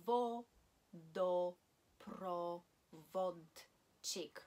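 vodoprovodchik, drawn out syllable by syllable; slow syllable by syllable. Shown as a demonstration. Teacher slowly modelling vodoprovodchik for learners, drawn out. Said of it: "Long word".

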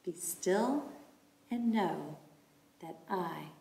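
slow, soft, drawn-out phrases; calm meditative recitation. Shown as a demonstration. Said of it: "Will you do that with me?".

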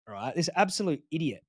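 normal talking voice

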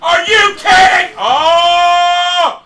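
high, loud wail of disbelief; outraged shout. Real, not acted. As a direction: yell out in protest. Outraged at the game.

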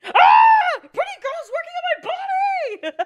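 very high, loud mock screaming; hammed-up shrieks. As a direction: ham up the panic.

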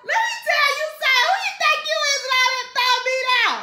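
high, loud playful ribbing; excited teasing. Real, not acted. Greeting friend Carmen, loud excited teasing.

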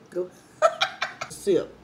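usual speaking voice

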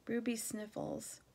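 ordinary delivery, nothing marked

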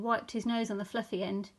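usual speaking voice

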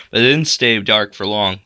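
ordinary delivery, nothing marked

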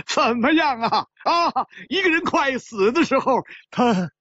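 high, exultant crowing; laughing triumph. Acted. Winner gloating over his lucky throw, exultant. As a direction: crow high, still full of laughter. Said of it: "陆小凤大笑起来".

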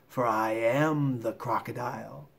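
slow, understated crocodile voice; coaxing crocodile. Acted. Crocodile revealing himself to the elephant child, slow and coaxing. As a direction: reveal it slowly and smoothly.

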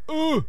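hoher, kurzer Schreckenslaut; jäh aufquieken vor Schreck; erschrockenes Oh. Real, not acted. Erschrickt im Spiel. (erschrockener, hoher Aufschrei)

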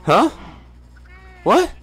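ordinary delivery

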